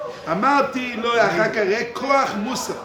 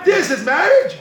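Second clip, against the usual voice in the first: higher and louder, laughing through it; laughing sarcasm; 6 semitones higher, 6 dB louder than usual. Shown as a demonstration. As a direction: scoff at the idea with a laugh. Sarcastic repeat about marriage.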